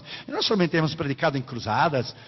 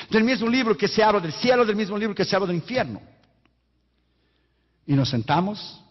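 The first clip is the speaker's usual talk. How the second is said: usual speaking voice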